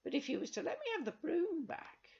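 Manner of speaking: high, sly wheedling; crafty old witch. Acted. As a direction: coax them slyly as the crone. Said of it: "she said craftily".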